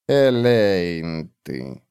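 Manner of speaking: low, very slow naming; slow low word. Acted. Old witch naming the dragons for Tarant, slow and low. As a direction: say the word slowly and heavily. Olar says word slowly.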